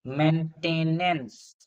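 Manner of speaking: "Maintenance" stretched very slowly, syllable by syllable; slowly spelled out. Shown as a demonstration. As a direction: draw out "maintenance" to check its spelling. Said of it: "maintenance नहीं होते".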